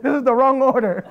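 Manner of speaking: high, loud mock protest; loud, laughing panic; retold panic. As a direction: protest in comic alarm. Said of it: "I was scared".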